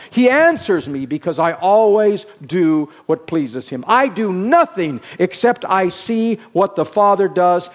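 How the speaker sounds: loud, forceful declaration; Jesus speaking emphatically. Retold or imitated. Preacher quoting Jesus on the Father, loud and emphatic. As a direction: preach it out as Jesus's own words. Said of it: "this is how he talked".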